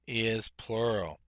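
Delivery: normal talking voice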